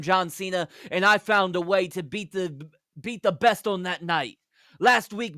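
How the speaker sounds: a touch of the British promo voice, rallying; hint of Nick Aldis. Narrator voicing Aldis's promo to the crowd, lightly accented.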